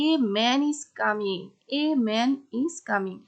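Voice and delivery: "a" said as a full "ay", stressed and slow; teacher stresses "a" as "ay" in "a man is coming". Shown as a demonstration. Teacher demonstrating the emphatic "a" to students, slow and stressed. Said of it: "যখন একটু pressure দিব, emphasise করব".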